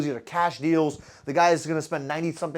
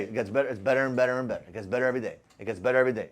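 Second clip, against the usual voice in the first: quiet mantra; 8 semitones lower, 7 dB quieter, faster than usual. Retold or imitated. Speaker to his audience, quoting his daily answer, low and soft. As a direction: lower and softer, repeated like a mantra. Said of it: "I don't know if you guys ever hear me say this".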